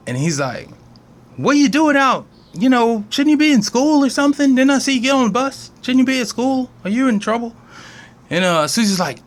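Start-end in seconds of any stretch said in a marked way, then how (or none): 1.4-7.6 concerned questioning. Retold or imitated. Trash man questioning Susie.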